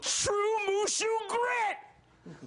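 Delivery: high, drawn-out mock cowboy holler; hammed-up slow campfire bellow; John Wayne. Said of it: "John Wayne singing around the campfire".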